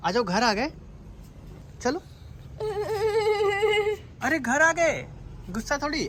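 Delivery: high, sing-song coaxing to the dog. Real, not acted. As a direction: lure the sleepy dog out gently. (कुत्ते को गाड़ी से उतारने के लिए ऊँची, प्यार भरी आवाज़)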